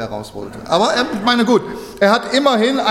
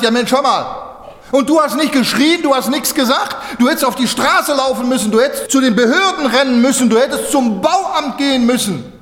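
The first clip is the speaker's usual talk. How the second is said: higher, pressing scolding; reproachful townspeople; 7 semitones higher, 3 dB louder than usual. Retold or imitated. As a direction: scold him for keeping silent. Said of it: "Und dann haben die gesagt". (höher, vorwurfsvoll schimpfend)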